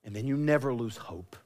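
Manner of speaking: ordinary talk, nothing marked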